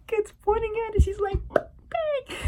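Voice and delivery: high squeaky laughing voice; helpless laughter. Real, not acted. Retelling the dropped gun scene, helpless laughter. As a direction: barely get the words out for laughing.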